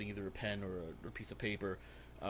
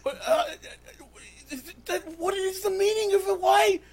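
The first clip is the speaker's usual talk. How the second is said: high, loud panicked demand; aghast; 11 dB louder than usual. Acted. Man aghast at his wife's corpse, panicking. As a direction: demand answers in loud horror. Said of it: "He's absolutely aghast and he's just shaking".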